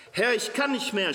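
high, loud, anguished cry to God; a desperate prophet's loud moan; groaning lament. A narrator's reading. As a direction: groan out "Herr, ich kann nicht mehr" in despair. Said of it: "stöhnte er".

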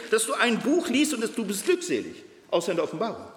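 normal talking voice

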